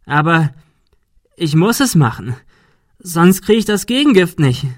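high, loud, halting insistence; desperate. A narrator's reading. Adrian explaining he needs the antidote, desperate. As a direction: build up in rising panic. Poisoned man forced to shoot.

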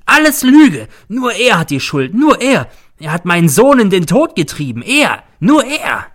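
high, loud, frenzied accusation; shrieking. A narrator's reading. Mother blames teacher for her son's death, shrieking. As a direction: hurl the blame in shrieked bursts. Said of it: "kreischt sie".

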